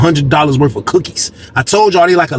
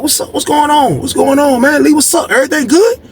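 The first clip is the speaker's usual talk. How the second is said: pitched-up concerned asking; higher, hammed-up what's going on; high-pitched crowd voices; 10 semitones higher than usual. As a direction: ask like the crowd gathering. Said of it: "everybody come out like".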